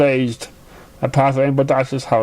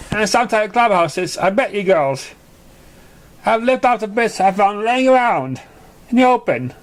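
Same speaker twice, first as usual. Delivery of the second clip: lightly raised filly voice; a filly telling her story; lightly voiced young pony; young pony explaining; 6 semitones higher than usual.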